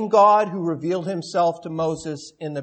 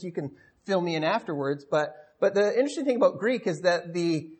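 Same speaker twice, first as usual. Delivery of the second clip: usual speaking voice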